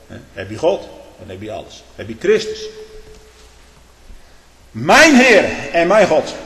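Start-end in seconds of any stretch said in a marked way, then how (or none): 4.7-6.3 slow, high, loud cry of faith; loud awed cry; exclaiming. Acted. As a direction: cry it out like Thomas. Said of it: "riep Thomas uit".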